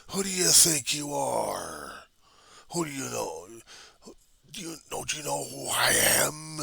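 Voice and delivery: high, slow, drawn-out cry of rage; anguished slow yell, pitched high; screaming at the universe. Acted. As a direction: hurl each word up at the sky, slowly. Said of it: "Scream and yell at the universe".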